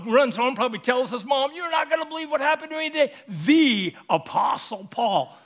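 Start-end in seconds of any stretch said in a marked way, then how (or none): 1.5-5.4 breathless excitement. Acted. Silas telling his mom the news.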